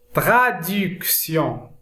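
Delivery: slow, syllable-by-syllable French word list; measured dictation with pauses between words; careful word modelling. Shown as a demonstration. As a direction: sound out each word for learners. Teacher reads example list for learners.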